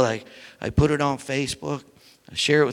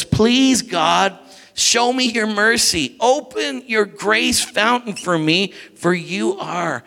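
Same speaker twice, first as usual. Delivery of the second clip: prayerful reading; 4 semitones higher, slower than usual. Acted. Reads psalm with feeling.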